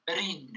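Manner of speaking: Brin as one syllable, no E between B and R; pronunciation demo. Shown as a demonstration. Teacher showing learners Brin without the E, pronunciation demo. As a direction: run B straight into R in Brin, clear I. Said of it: "comme s'il n'y avait pas E".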